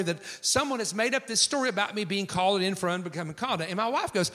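ordinary delivery

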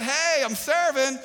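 high, loud showing-off voice; selfie show-off. Pretend. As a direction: brag to the camera. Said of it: "serve and we take a selfie".

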